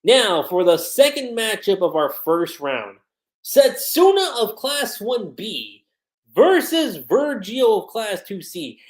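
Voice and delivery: loud, slow arena announcer call. Retold or imitated. Vlogger voicing the tournament announcer, booming and slow. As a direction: announce the matchup to the whole stadium. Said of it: "the announcer saying".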